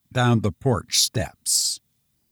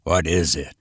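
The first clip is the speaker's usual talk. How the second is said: gruff commissioner; 7 semitones lower than usual. Acted. Commissioner's low voice.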